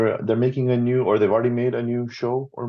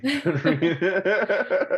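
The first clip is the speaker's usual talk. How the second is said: laughing; 11 semitones higher, much slower than usual. Real, not acted. Laughing while joking about stealing.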